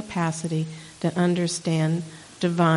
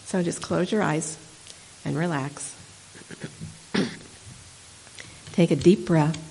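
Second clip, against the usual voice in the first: guided meditation. Acted. Leads a short meditation.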